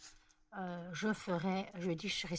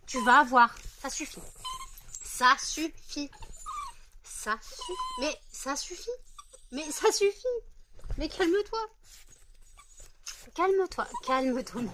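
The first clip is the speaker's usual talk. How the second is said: higher and louder, telling her that's enough; high scolding; 9 semitones higher, 4 dB louder than usual. Real, not acted. To the overexcited dog, a loud high half-laughing scolding. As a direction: firmly settle the trembling dog.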